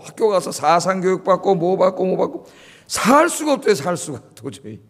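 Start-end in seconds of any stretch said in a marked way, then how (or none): none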